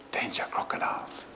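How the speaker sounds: a hushed mock reading of a warning sign; mock whisper. Speaker mocks a lakeside sign for locals in a whisper. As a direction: whisper the sign's words in a hushed, deadpan breath.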